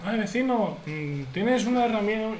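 a soft, wheedling ask, only lightly put on; sweet-talking the neighbour. To the handy neighbour, a hint of coaxing favour-asking.

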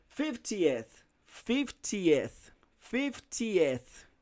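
"Fiftieth" drilled with each part separated, ending in "eth"; clear -eth ending. Shown as a demonstration. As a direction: repeat "Fiftieth" carefully, sounding the E and TH. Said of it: "Em vez de falar fifty".

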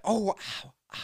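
high, soft, hurting little ows; mock pained yelps. Friend imagines cohost wincing in a chair, mock pained. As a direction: whimper in mock pain from a sore back. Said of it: "be like".

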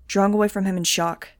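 usual speaking voice

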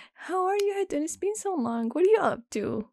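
high-pitched nasal mimicry; imitating nasal friend. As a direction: put on the annoying friend's high voice. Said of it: "She talks like this".

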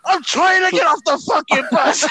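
high, loud protest acted out; exasperated retold yell. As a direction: yell back at the driver in mock desperation. Retold yelled retort to angry driver.

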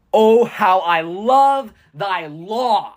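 slow, ringing, joyful exclamation; slow and exultant, savouring each word; exclaimed with joy. Shown as a demonstration. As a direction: read it like it ends with an exclamation point. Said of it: "I just wanna scream it".

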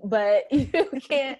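slow words broken by a laugh. Real, not acted. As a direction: laugh through it, slowing down. Laughing at her own past stance.

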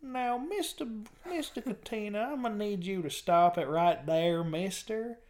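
mock old man. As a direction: scold like a cranky old man.

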